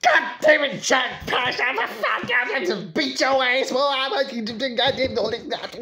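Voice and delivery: high-pitched furious grandma rant; hammed-up sputtering tirade, voice shooting up; losing it. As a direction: imitate grandma flying off the handle. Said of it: "she would freaking lose her shit".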